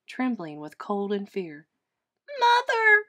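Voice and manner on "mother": put-on high, loud baby bat squeak. A narrator's reading. Lost baby bat calls for mother, squeaking. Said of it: "Stella Luna squeaked".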